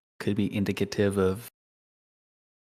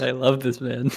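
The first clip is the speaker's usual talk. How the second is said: ordinary talk, nothing marked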